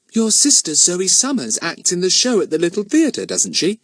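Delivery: high, innocently curious. A narrator's reading. Girl fishes for information from her host, innocent. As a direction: ask as if you have no idea. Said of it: "she said innocently".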